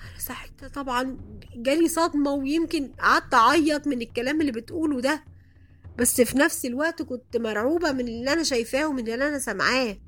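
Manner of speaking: ordinary delivery, nothing marked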